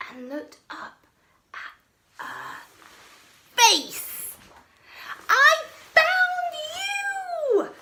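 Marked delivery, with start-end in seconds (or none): 5.3-7.7 very slow, high, loud joyful shout; boy finally meeting the Yeti; overjoyed cry. Acted. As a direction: stretch it out in triumph. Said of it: "cried George with a big happy grin".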